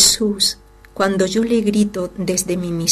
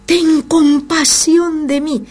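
higher, slow drawn-out plea; pleading cry; 7 semitones higher, slower than usual. Acted. Blind man pleading with Jesus, higher slow cry. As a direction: beg for mercy, stretching it out. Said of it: "él gritaba cada vez más".

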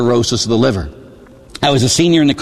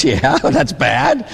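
laughing; much slower than usual. Retold or imitated. Minister laughing at the prayer.

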